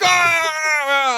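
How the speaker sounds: high put-on cry; a high, strange mock cry for the dead cat; puppet cat cry. Goon working the dead cat like a puppet, high mock cry. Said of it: "He's like".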